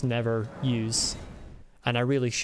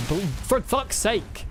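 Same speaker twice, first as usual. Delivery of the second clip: frustrated outburst; 7 semitones higher, slower than usual. Real, not acted. Interrupted by game hit.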